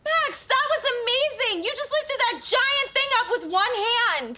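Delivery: high-pitched, breathless-with-wonder exclamation; amazed. Acted. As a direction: gush in astonishment at a friend's feat. Amazed at friend's feat of strength.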